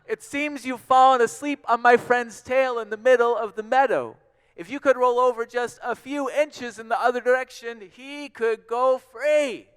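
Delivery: polite request at full shout. A narrator's reading. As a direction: holler up to someone far above. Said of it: "he shouted up to those giant elephant ears".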